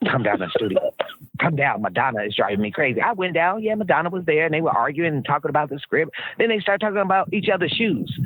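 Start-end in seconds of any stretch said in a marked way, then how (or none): 0.1-3.0 lower, lightly voiced [name] pleading; imitating [name]. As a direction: coax her down like [name], low. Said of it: "I'm doing this like him".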